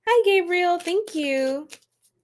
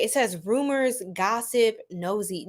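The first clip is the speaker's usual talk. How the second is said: ordinary talk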